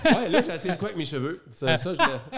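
laughing while talking about hair; quick laughing reply. Real, not acted.

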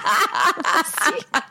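high burst of laughter. Real, not acted. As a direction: laugh out at the cheeky admission. Laughs at own confession.

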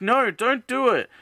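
high, imploring cry in retelling; mock alarmed plea. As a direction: act out begging someone to stop. Retold plea to stop a friend.

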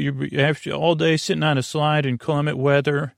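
usual speaking voice

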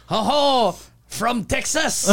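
hammed-up imitation of a delighted stranger; French guy guessing. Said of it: "there was a guy that asked him".

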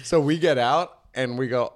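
ordinary talk

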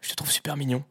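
re-enacting a girl murmuring a compliment close to the mic; soft murmur in the ear. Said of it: "Elle me dit dans l'oreille".

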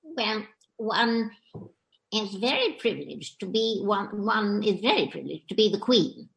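lightly voiced regal Queen; a gentle hint of the Queen's royal "one". Impressionist answering the host as the Queen, lightly regal. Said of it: "Who are you doing".

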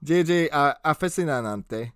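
usual speaking voice

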